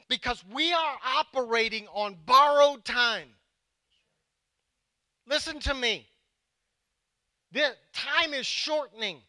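high, forceful preacher's delivery; urgent preaching. Real, not acted. Pastor warning that time is short, urgent preaching. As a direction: drive each phrase home with weighty pauses.

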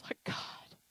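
breathy unvoiced appeal, re-enacted; retold hushed plea. Preacher retelling her plea to God, hushed. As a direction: imitate a soft, overwhelmed appeal.

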